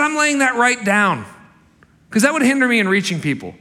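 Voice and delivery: Paul quoted. Retold or imitated. Preacher voicing Paul laying his right down, loud and high. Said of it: "And he said".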